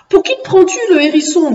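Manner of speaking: high-pitched, narrated indignation; indignant growl. A narrator's reading. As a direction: challenge the hedgehog in mock rage. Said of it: "grogna Zoula, les yeux étincelants de rage". (grognement indigné, joué)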